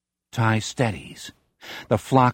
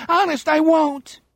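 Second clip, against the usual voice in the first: put-on much higher, panicked gibbering boy. A narrator's reading. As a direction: gibber it in terror. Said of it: "he gibbers".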